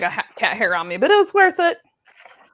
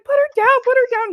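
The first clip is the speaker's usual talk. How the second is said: high, loud alarmed shout; panicked yell; 14 semitones higher, 5 dB louder than usual. Retold or imitated. Owner rushing over, shouting at the friend to drop the cat. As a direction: yell it in a panic to stop him.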